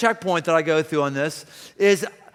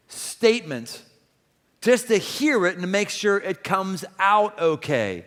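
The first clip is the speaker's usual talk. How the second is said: normal talking voice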